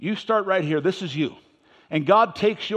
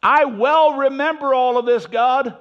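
high, slow, loud complaint to God; whining prophet; 7 semitones higher, 7 dB louder, slower than usual. Acted. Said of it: "He's still whining".

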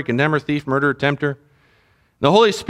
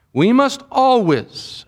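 "always" drawn out slow and higher; emphatic ALWAYS; 4 semitones higher, much slower than usual. Real, not acted. Pastor urging the congregation to guard their thoughts, heavy stress on "always". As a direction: lean hard on "always", stretching it. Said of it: "I cannot emphasize the word always enough".

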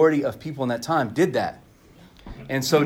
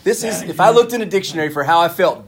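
higher and louder, a mock outburst ending in a laugh; hammed-up exasperation; 7 semitones higher, 8 dB louder than usual. Preacher replaying his angry thoughts to the congregation, hammed-up and loud. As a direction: act out your own fed-up rant with a grin. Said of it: "I'm like".

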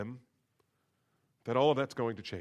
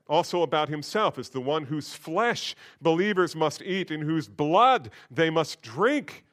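ordinary delivery

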